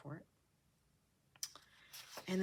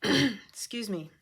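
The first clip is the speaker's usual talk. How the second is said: slower hoarse apology; throat clearing; 4 semitones higher, 9 dB louder, slower than usual. Real, not acted. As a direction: clear throat, then slowly. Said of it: "leftovers from yesterday".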